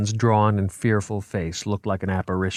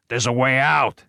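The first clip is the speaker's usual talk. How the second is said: raised urgent call; shouted; 4 semitones higher, 3 dB louder than usual. A narrator's reading. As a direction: insist over the panic. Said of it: "shouted D'Agusta".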